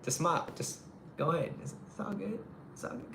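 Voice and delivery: mock sugary nice-guy at the stop sign; smiling easygoing driver. Shown as a demonstration. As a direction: coo it like you mean no rush at all. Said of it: "Just be like".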